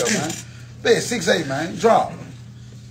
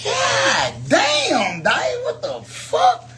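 laughing exasperated yell; 8 semitones higher, much slower than usual. Real, not acted. Reacting to another bad roll.

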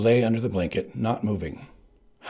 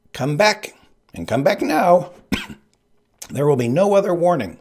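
higher, louder ultimatum; Sicilian's warning; 8 semitones higher, 7 dB louder than usual. Acted. Sicilian warning Buttercup to return, loud. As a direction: give the last warning.

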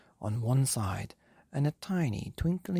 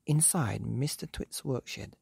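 ordinary delivery, nothing marked

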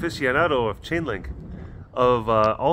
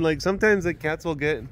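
ordinary talk

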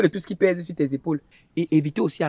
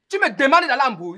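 high-pitched indignant challenge; 8 semitones higher than usual. Acted. Brother offended by his sister's insult, indignant. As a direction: question them in outraged disbelief.

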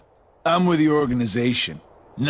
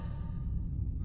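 breathy secret villain laugh; whispered chuckle; 13 dB quieter than usual. Acted. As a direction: laugh under the breath, teasing.

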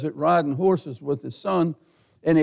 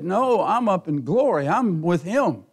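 ordinary talk

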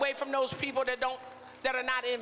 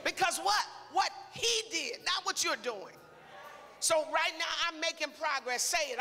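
ordinary talk, nothing marked

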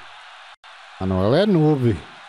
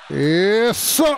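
excited shout; 8 semitones higher than usual. Real, not acted. Celebrating a chance.

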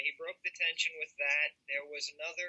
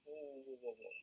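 calm monotone; 14 dB quieter than usual. Acted. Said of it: "monotone way".

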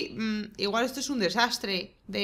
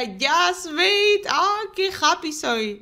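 cheering; 7 semitones higher, 6 dB louder, much slower than usual. Real, not acted.

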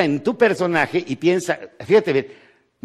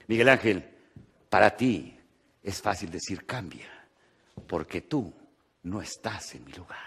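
mock self-pity; 6 semitones lower, 8 dB quieter than usual. Quoting a self-pitying complainer.

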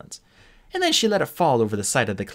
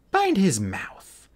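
slow, higher commanding dragon queen; commanding order; 9 semitones higher, much slower than usual. A narrator's reading. Queen commanding her soldiers, slow and high. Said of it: "the waiting dragon ordered".

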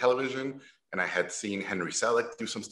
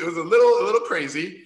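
ordinary talk

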